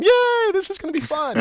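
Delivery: a burst of put-on delight; mock cheer. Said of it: "I should be going".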